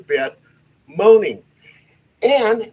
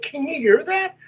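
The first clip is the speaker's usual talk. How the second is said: high-pitched astonished question; can't believe your ears, pitched up high; amazed; 9 semitones higher than usual. Retold or imitated. Investigator asking the team about a giggle, amazed.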